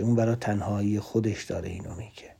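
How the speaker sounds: quick hushed whisper. Real, not acted. Speaker to audience, revealing loneliness in a quick whisper. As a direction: let it slip out fast and quietly.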